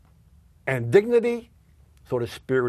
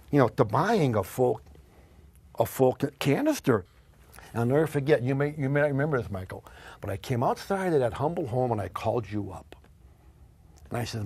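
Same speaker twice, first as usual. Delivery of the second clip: normal talking voice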